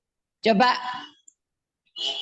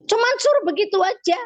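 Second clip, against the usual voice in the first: amazed exclamation; 8 semitones higher, faster than usual. Real, not acted. Marvels at the simple command.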